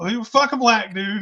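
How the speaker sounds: ordinary talk, nothing marked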